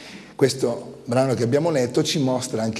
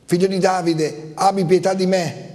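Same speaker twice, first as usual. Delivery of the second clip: higher, pleading cry in a reading; raised-pitch plea, a restrained shout; 6 semitones higher than usual. A narrator's reading. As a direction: call out for mercy, more insistently. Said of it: "quello gridava più forte".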